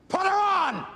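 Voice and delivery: sudden loud outburst; demanding shout. Acted. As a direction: bark the order down the phone. Demanding to talk to Jill.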